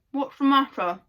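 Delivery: talking through cake; mouth full. Acted. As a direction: say it round a mouthful. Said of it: "with her mouth full of cake".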